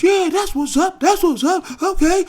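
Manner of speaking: high, loud bleacher cheer; hyped crowd taunt; cheering parents. Retold or imitated. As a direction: whoop it up like a parent in the stands. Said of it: "all they parents".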